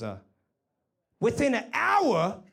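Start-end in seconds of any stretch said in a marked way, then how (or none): 1.2-2.4 excited shout. Retold or imitated. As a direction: blurt it out overjoyed, much higher. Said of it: "he just goes".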